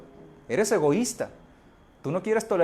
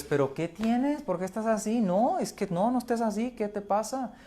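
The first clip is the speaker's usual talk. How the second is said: nagging partner begging to know what's wrong; pleading partner; 8 semitones higher than usual. Retold or imitated. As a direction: plead anxiously, voice pitched way up. Partner pleading with angry spouse.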